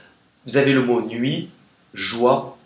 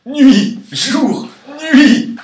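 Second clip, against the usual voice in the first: high, loud mock yell on single words; cri enjoué; 13 semitones higher, 11 dB louder, much slower than usual. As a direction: shout each word high, as a playful outburst. Playful calling out of pile word. (crié très aigu, pour rire)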